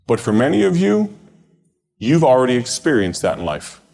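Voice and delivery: high-pitched, projected sermon delivery with long pauses; preaching. Real, not acted. Preacher urging campers to let go of their plans, preaching. Preaches a recorded camp message.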